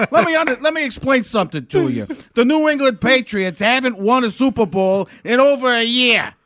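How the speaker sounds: put-on thick Boston sports-fan accent. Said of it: "you gotta work at this accent".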